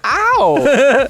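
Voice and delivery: squeaky yelp of pain; high yelped "ow". Retold or imitated. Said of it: "I went".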